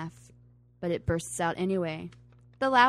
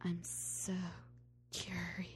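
whisper; 13 dB quieter, slower than usual. Retold or imitated. Whispered quote.

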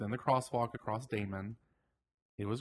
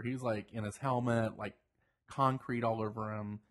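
usual speaking voice

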